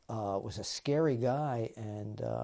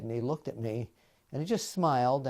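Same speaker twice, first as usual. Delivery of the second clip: ordinary talk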